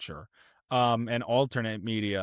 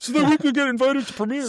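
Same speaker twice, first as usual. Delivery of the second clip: high, loud, fast fake eagerness; hammed-up excitement about invites; mock eager; 12 semitones higher, 6 dB louder, faster than usual. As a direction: gush like a starstruck fan. Joking about their own ambitions.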